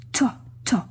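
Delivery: "To, to" as two separate beats with a clear T; teacher isolating "To" for a learner; syllable demonstration. Shown as a demonstration. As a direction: sound out "To" twice, stressing the opening T. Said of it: "Tu entends le T".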